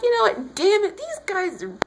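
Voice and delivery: high-pitched, hammed-up exasperation; exasperated outburst. Venting about Apollo's insults, exasperated and high. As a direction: blurt it out in mock outrage. Voices outrage at Apollo's rude family.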